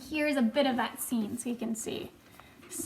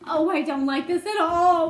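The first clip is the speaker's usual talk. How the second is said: loud, whining put-on voice; wailing; 2 semitones higher, 7 dB louder than usual. A narrator's reading. Earthworm dreading another seagull, wailing protest. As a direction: complain in a miserable wail. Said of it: "wailed the Earthworm".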